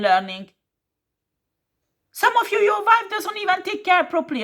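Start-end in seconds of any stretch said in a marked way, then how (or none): 2.1-4.4 raised, indignant rant pitched up high; exasperated shout at the listeners; angry outburst. Real, not acted. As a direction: scold them, voice jumping way up. Ranting at viewers about their wives.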